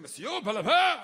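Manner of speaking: loud fake French address; loud mock French. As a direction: call out in hammed-up French. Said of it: "He goes".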